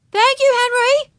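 put-on very high, loud little-girl call; calling after someone. A narrator's reading. Girl to the departing traffic boy, calling out. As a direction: sing out thanks to someone leaving. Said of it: "Ramona called after him".

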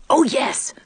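high, eager little yes; childlike eager assent. A narrator's reading. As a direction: agree readily like a keen child. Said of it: "eagerly wriggling down on his knees".

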